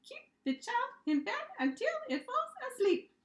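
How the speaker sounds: high, squeaky monster voice; bouncy, squeaky monster answering the teacher; squeaky little monster. Acted. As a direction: squeak it out like an eager tiny creature. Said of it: "The orange monster bounced and squeaked".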